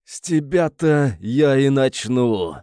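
slow menacing villain hiss. Acted. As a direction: hiss it slowly at the victim. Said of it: "прошипел он".

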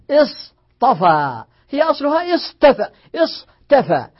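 اصطفى with heavy emphatic ṭ, not plain t. Shown as a demonstration. Teacher to students, demonstrating emphatic ṭ. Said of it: "من التخفيف".

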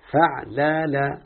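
فعلل spoken very slowly, each letter distinct; slow demo. Shown as a demonstration. Teacher slowly giving the pattern فعلل for دحرج. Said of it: "يبقى دحرج على وزن إيه".

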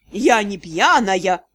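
low, slow, slurred mimic; tongue-tied drunk imitation; slurred mockery. A narrator's reading. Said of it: "заплетающимся языком передразнил меня Джокер".

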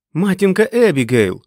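high, loud shout; shouting over engine. A narrator's reading. Dick shouts news over the motorbike engine, shouting. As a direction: yell it over roaring motorbikes. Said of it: "Дік перекрикував мотор".

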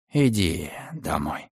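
slow falling lullaby hush; lulling. A narrator's reading. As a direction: rock it to sleep with words. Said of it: "укачивая её". (убаюкивающе)

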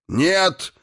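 high, sudden cry of protest; alarmed shout. A narrator's reading. Dwarf Dvalin blocks Irina's path, alarmed shout. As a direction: burst out to stop her.

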